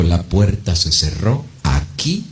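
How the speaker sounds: low, slow, soft solemn pronouncement; God's decree. Acted. God closes Herod's door, low, slow and solemn. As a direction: pronounce the closing of the door gravely. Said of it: "ese día Dios dijo".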